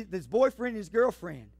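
usual speaking voice

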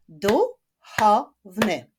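"Duchowny" in three slow, loud, separate beats; split into syllables. Shown as a demonstration. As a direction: clap out "Duchowny" slowly, syllable by syllable. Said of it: "Zapraszam do wyklaskiwania sylab".

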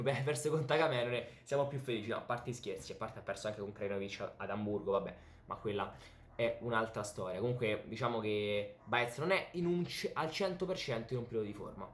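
normal talking voice

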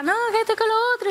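high-pitched imitation of arguing back. Snorer standing up to argue back, acted protest.

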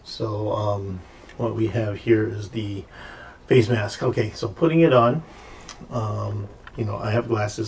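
normal talking voice

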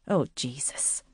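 a soft, low, mortified moan. A narrator's reading. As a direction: groan it out under her breath, embarrassed. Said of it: "Katy moaned".

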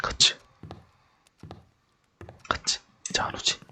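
soft unvoiced whisper; whispering while hiding. Real, not acted. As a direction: whisper so you stay hidden. Hiding from the witch.

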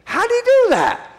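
sudden high astonished question; amazed. Retold or imitated. Preacher marvels at vanishing man.

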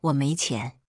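low, icy refusal; ice-cold. A narrator's reading. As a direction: shut it down coldly. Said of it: "冷冰冰地说".

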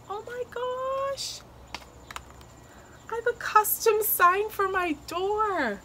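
high, slow, stretched-out awe; amazed delight. Real, not acted. Finding a custom sign for the door, amazed. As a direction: gush high, lingering on the surprise.

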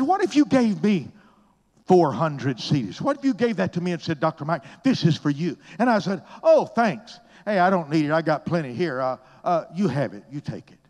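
loud, bright mocking of a polite refusal. Preacher mocking a pastor who refuses gifts, loud and high. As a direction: ham up a man brushing off a gift.

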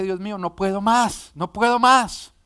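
rising anguished plea, loud at the end; desperate cry. Retold or imitated. Husband crying out to God, crushed by fear, in a loud desperate plea.

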